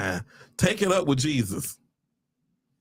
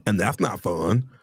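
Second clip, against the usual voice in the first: ordinary talk, nothing marked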